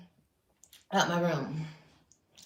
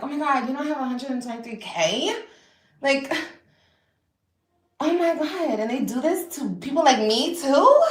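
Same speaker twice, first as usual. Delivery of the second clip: higher, louder mock disbelief; mock shock; 6 semitones higher, 6 dB louder than usual. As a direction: play up the surprise. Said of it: "I was like".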